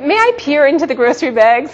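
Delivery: put-on high, laughing toddler voice; laughing through the kid's prim question; a small boy's formal ask, laughed out; child quote with laughter. Mother voicing her toddler's words.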